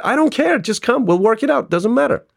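very high-pitched, emphatic brushing-off; retold insistent reassurance. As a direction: wave away the worry urgently. Retold urgent reassurance to caller.

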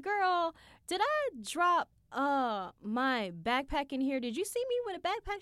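high, hurried anxious question; worried asking. Retold or imitated. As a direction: rush it like you lost something. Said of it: "I'm like".

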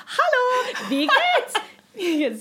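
high, laughing, overeager German greeting; a tipsy tourist showing off her German, laughing; overeager drunk German. Retold or imitated. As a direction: chirp it out tipsy and proud. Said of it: "And I started off like".

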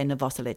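"Novoselic" as no-VOSS-elitch; Croatian-style pronunciation. Shown as a demonstration. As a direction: stress the VOS in "Novoselic". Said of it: "I think he was Croatian".

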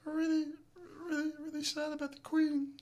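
squeaky high pop-star impression. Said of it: "who would I like to see you impersonate".

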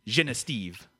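slow, low French pronunciation; French-Canadian town-name delivery; French accent. Acted. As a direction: draw out "Genestive" as one French word. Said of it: "said it with a French accent".

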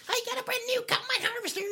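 very high, fast squeaky squirrel voice; chipmunk-speed squeak. Pretend. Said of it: "Or you be like".

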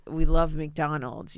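usual speaking voice

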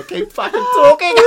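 squealing high through laughter; laughing. Real, not acted. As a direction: blurt it out mid-laugh. Laughing after sign-off.

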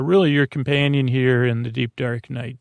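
normal talking voice

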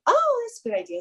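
high-pitched "oh" of sudden agreement; mock bright realisation. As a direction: act out being won over instantly. Retold sudden agreement with shop owner.

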